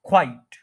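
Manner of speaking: "क्वाइट" as kwite, one beat; single syllable. Shown as a demonstration. Teacher recapping before playback, one-syllable pronunciation. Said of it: "one syllable है".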